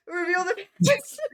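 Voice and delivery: high, loud and frantic, with a crack in the voice; panicked shout. Acted. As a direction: blurt the spell out in panic. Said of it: "like, panic as".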